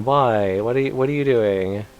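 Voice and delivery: usual speaking voice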